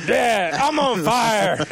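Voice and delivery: slow, loud, high laughing shout; taunt shout. Retold or imitated. Showing friends how he spams the taunt, with a slow, loud, laughing shout. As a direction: spam the taunt with glee. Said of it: "this constant taunt of".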